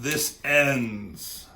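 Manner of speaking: restrained mock battle cry; yelling out. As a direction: call it out as a final warning, half-shouted. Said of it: "and someone yells out".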